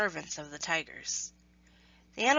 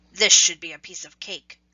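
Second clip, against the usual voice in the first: cocky tiger; 2 semitones lower, 9 dB louder than usual. Acted. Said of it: "the first and strongest tiger said".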